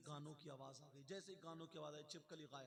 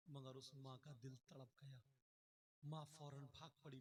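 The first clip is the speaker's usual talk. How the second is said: ordinary talk, nothing marked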